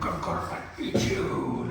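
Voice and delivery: slow, very low growl; playful growling predator. Pretend. Play fighter threatening to eat the partner, mock growl. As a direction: growl it slowly like a pretend monster. Plays predator chasing its prey.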